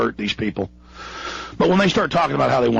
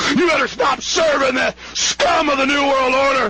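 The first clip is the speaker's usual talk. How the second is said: furious shout; 12 semitones higher than usual. Real, not acted. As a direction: scream the warning with fury. Ranting threat to cops and military.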